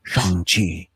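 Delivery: deep, low voiceover saying Shang-Chi as SHAHNG-chee; movie voiceover. Pretend. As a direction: intone it like a title-card announcer, very low. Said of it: "they've added in a voiceover".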